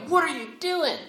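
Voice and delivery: high, outraged cry of disbelief; outraged Moses. Acted. Moses sees the golden calf.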